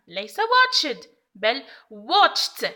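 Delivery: "watched" first with a d ending, then slowly with a crisp t; correcting her own slip, slowly; self-correction demo. Shown as a demonstration. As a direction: contrast the wrong "watched" with the correct "wotcht". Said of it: "ها قد ارتكبت خطأ".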